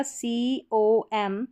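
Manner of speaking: C O M letter by letter, very slow; spelled out slowly. Shown as a demonstration. Teacher spelling letters for students, very slow. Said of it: "break करके पढ़ना".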